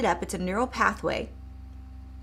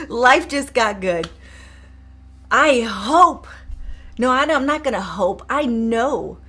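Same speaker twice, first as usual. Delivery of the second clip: excited; 3 semitones higher, 8 dB louder than usual. Real, not acted. Excited closing pep.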